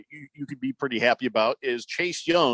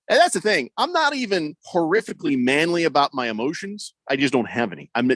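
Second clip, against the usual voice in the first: ordinary talk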